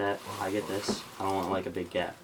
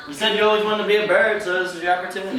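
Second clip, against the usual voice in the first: loud teasing; 7 semitones higher, 7 dB louder than usual. Real, not acted. Teasing mom after prank.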